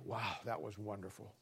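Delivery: low, very soft wonder; hushed mock awe. Preacher imitating pleased churchgoers leaving a service, hushed mock awe. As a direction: breathe it out quietly, as if impressed.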